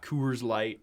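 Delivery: "Coors" in "Coors Light" with a drawn O; drawn-out O's. Shown as a demonstration. Imitating Coloradans, "Coors" with drawn-out O. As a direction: lengthen the vowel in "Coors". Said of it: "you guys really draw those O's".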